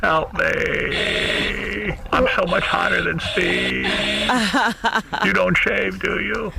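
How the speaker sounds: slow breathy pleading put-on; lightly voiced needy caller; Christopher Reeves voice. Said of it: "this is Christopher Reeves".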